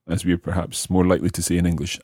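ordinary delivery

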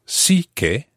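"sí que" said slowly, word by word; slow, deliberate. Shown as a demonstration. Teacher isolating the phrase, slow and careful. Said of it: "to emphasize that verb".

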